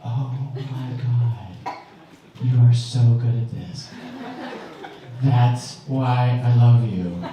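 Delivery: low, slow, hushed gushing; doting wife. Retold or imitated. Wife praising husband's parroting, slow low coo. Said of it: "she's like".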